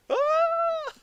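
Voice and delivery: very high, loud mock shriek of horror. Mocking staff touched by a copy of Mein Kampf, a loud high mock shriek. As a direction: shriek like someone touched by the cursed book.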